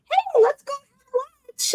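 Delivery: high, slow sugary invite; sweet fake-casual voice. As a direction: put on a sugary office voice. Mock casual workplace invite.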